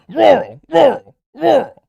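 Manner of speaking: high, loud yelping barks of the word; barking dog. Acted. Streamer mocking rural voters as barking dogs. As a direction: bark "Rural" like a yapping dog. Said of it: "It's like they're fucking barking".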